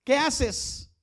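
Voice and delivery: high, loud pulpit cry; fiery preaching. Acted. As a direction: call it out to the whole congregation. Preacher opening question loudly.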